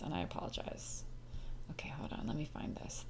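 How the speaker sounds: unvoiced apologetic whisper under her breath; whispered aside. Real, not acted. Podcaster searching her ebook, whispering an apology. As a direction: mutter it to yourself while searching.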